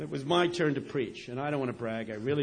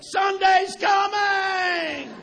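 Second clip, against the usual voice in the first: long, high, drawn-out shouted reply; the crowd roaring the answer back slowly; yelled back; 8 dB louder, much slower than usual. Retold or imitated. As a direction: congregation shouting back to the preacher. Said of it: "congregation yelled back".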